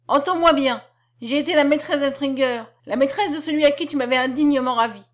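sharp, forceful, high-voiced tirade; high-pitched retort. A narrator's reading. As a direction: fling it at the husband in a high voice. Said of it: "répliqua-t-elle d'une voix aiguë".

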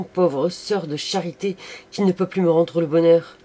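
loud, high, fervent lament; impassioned outburst. Acted. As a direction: pour out regret at full voice. Repentant lover's passionate outburst. (effusion passionnée, à pleine voix)

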